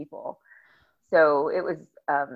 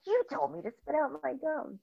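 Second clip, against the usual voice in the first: indignant; 10 semitones higher, 9 dB quieter than usual. Retold or imitated. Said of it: "she was so indignant".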